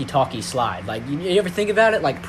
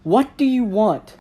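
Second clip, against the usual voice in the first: exasperated; 6 semitones higher, 7 dB louder, slower than usual. Acted. Annoyed at Marvin's interruptions.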